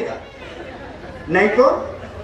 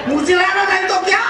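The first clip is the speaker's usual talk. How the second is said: high, punchy retold urging; exclaimed advice; 8 semitones higher than usual. Old woman urging the wife to ask back, exclaiming. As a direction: call it out high and boldly.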